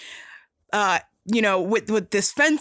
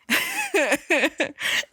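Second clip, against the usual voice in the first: high laugh; 7 semitones higher than usual. Retold or imitated. Wesley flirting line.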